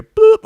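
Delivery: high squeaky scanner beep. Retold or imitated. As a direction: make a machine beep noise.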